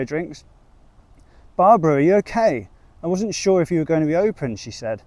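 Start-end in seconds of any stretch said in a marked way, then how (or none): 1.6-4.6 worried woman voice, higher and louder. A narrator's reading.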